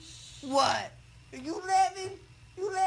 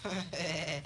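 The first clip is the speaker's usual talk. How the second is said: low, very slow, stretched words; blissed-out groggy; 8 semitones lower, 6 dB quieter, much slower than usual. Real, not acted. Patient asked how they feel, slow low bliss. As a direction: drag it out low and dazed. Sedated patient drifting, chuckling.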